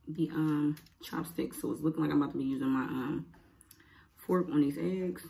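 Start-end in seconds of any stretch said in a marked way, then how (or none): none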